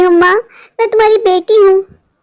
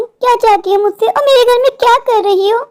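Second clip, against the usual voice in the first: ordinary delivery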